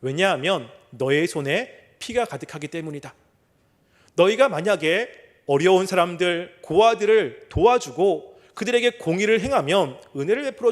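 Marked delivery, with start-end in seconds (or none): none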